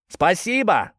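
shouting thanks into the reeds; calling out. Acted. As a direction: call loudly toward the reeds. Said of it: "крикнул ротмистр".

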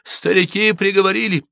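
put-on high, plain village girl explaining; matter-of-fact girl. A narrator's reading. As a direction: state the elders' verdict simply. Объяснила Манефа. (спокойно объясняя)

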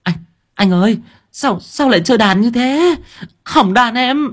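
put-on high, whining girl voice; wailing girl. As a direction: wail over the broken guitar. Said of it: "rú lên". (giọng con gái cao, rên rỉ)

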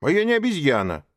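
high, loud offended protest; indignant child protesting. Acted. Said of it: "обиженно возразил Маугли".

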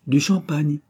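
jeune voix claire, plus haute; voix de cristal. A narrator's reading. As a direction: une réponse légère et malicieuse. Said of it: "de sa voix de cristal". (la bouquetière répond au vieux galant, voix claire)

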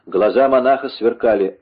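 ordinary delivery

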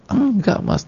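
very high whimpering no; mock tearful denial. Wife still crying at husband's guesses, mock whimper. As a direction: pretend to cry through it. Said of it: "nangis lagi gitu loh".

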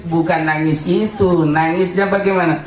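ordinary talk, nothing marked

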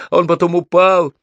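high, loud, urgent yell; boy hollering in alarm; yelling back. A narrator's reading. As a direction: shout the news over the din. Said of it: "заорал я в ответ".

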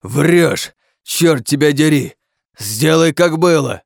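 loud, high shouting; furious accusation. Acted. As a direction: shout an angry demand at a liar. Accuses princess of lying.

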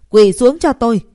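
a loud, angry shouted order; shouting. A narrator's reading. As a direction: yell the order at her. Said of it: "hét lớn". (hét lớn ra lệnh)

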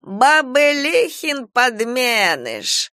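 high, slow, stretched cry; triumphant shout. A narrator's reading. Old woman naming the changeling, triumphantly shouting. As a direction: proclaim it in triumph. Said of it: "торжествующе выкрикнула она".

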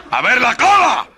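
very high, loud shout with laughter; laughing shrieked order. Acted. Sergeant screaming at the soldier, near laughing.

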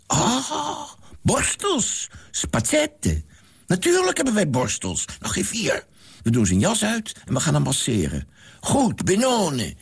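put-on high, excited old servant's voice; eager old man. A narrator's reading. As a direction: bustle about once it clicks. Old man finally understands. (hoge, opgewonden oude man)

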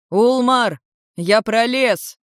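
loud hail into a passage; calling out. A narrator's reading. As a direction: shout through to someone on the far side. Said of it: "крикнул в проход".

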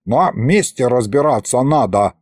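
ordinary delivery

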